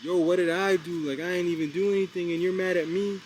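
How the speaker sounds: high, loud hurt innocence; playing victim. Nate fakes innocence to the homeless guy, loud and high. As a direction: whine like the wronged party. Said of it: "And I'm playing victim".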